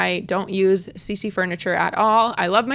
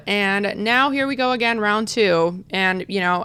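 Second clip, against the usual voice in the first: ordinary delivery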